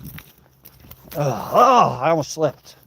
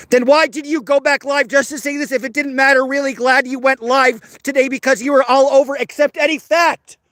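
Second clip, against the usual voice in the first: much higher, mocking read of a viewer's comment; mock chat voice; 11 semitones higher, 3 dB louder than usual. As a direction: read the message in a high mock voice. Reading a hostile chat message aloud.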